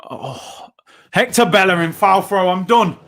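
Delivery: ordinary talk, nothing marked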